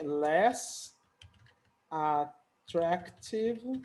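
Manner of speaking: attractive pulled out very slowly, syllable by syllable; very slow teaching pronunciation; very slow, drawn out. Shown as a demonstration. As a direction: model attractive at a crawl for the class. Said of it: "tem várias sílabas".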